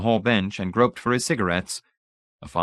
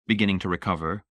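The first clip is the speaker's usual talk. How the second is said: ordinary delivery, nothing marked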